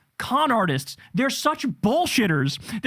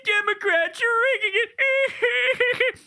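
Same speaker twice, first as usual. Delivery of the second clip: much higher, slow, whiny mocking voice; mock whining Republicans; 11 semitones higher, much slower than usual. Host mocking complaining Republicans, high slow whine. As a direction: drag it out like a crybaby caricature. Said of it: "And the Republicans".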